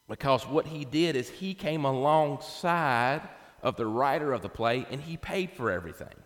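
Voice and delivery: normal talking voice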